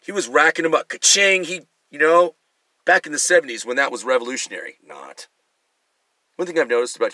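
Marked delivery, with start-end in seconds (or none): 0.9-1.4 high, loud cash register ding; mock register ding; ka-ching sound. 4.8-5.3 much lower, very soft sarcastic "Not"; throwaway sarcastic tag; deadpan not. Acted.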